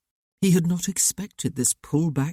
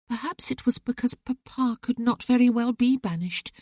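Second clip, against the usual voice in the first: put-on higher young woman, earnest and hurt; young sister's voice; 7 semitones higher than usual. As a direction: let the sister pour out old grievances to her brother. Sister remembers sad losses.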